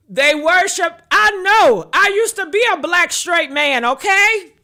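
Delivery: shouting, fired up about the point; excited shout. Real, not acted. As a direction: belt it out, worked up and laughing.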